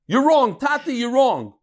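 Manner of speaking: high-pitched, blunt correction of a father; blunt contradiction. Acted. Son bluntly correcting his father in learning, blunt and high. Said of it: "Don't say it like that".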